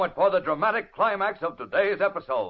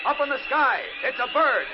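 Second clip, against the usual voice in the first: high, excited shouting; excited calling out; 7 semitones higher than usual. Acted. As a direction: call out in amazement. Excited crowd calls out.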